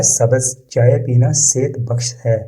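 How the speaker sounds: ordinary talk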